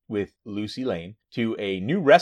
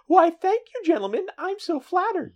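put-on woman voice, very high falsetto; mock-feminine squeak, hammed up; Jimmy Olsen; 4 dB louder than usual. Man in drag thanking the gentlemen, fake woman voice. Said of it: "That's his woman voice".

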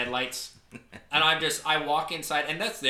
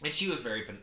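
ordinary delivery

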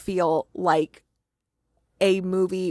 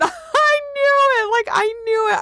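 much higher and louder, laughing and half crying; excited squeal; 5 dB louder than usual. Real, not acted. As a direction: burst out with real joy. Hero reappears, she screams joy.

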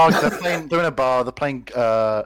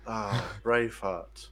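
soft musing; 4 semitones lower, 9 dB quieter, slower than usual. Acted. Doctor sighing, musing.

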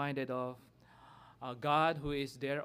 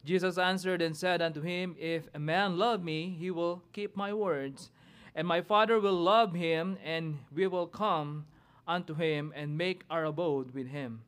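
scripture reading; 4 semitones higher, 3 dB louder than usual. Acted. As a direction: proclaim the verses from the pulpit. Reads scripture aloud, responsive reading.

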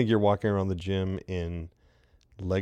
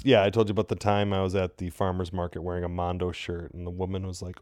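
normal talking voice

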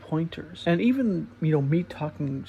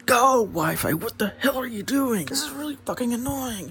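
higher, louder mock-angry yell at the Wi-Fi; imitating mind yelling; 8 semitones higher, 4 dB louder than usual. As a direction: rant at the router like the frustrated mind. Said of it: "your mind just keeps yelling at you".

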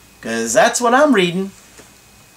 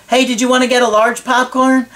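higher, light offering voice; cheery partner voice; 8 semitones higher, faster than usual. Retold or imitated. Acted partner offering popcorn.

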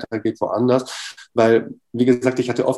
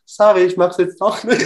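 hammed-up fake apology; mock apology; 7 semitones higher than usual. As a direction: play a sheepish cop-out as a joke. Mock backing out, ironic quote.

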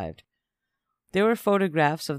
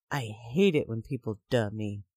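ordinary delivery

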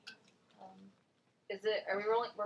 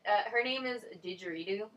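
normal talking voice

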